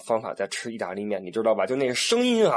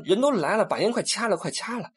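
higher, urgent plea fading to hushed; urgent hushed urging; 8 semitones higher than usual. Retold or imitated. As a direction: hurry them, manager is watching. Guide urging, manager right behind. (经理在身后，导游急着催)

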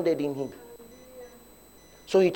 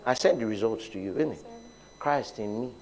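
much softer, lower murmured prayer; speaking inside; 5 semitones lower, 9 dB quieter than usual. Retold or imitated. Preacher retelling his silent confession at the clinic, very softly. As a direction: say it under your breath to yourself. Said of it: "I began to speak inside".

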